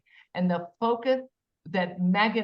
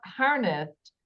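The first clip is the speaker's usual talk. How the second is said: usual speaking voice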